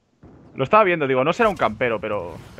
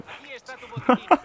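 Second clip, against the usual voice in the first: carcajada; 13 semitones higher than usual. Real, not acted. Broma del doble monitor.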